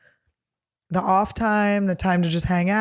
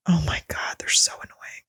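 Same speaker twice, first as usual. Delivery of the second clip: whisper; 2 semitones lower, 3 dB quieter than usual. Real, not acted. Annoyed aside about texts.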